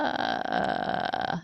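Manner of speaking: a purring low creak with no tone; light vocal fry. Shown as a demonstration. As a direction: purr it like a cat. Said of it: "it's almost like a cat purring".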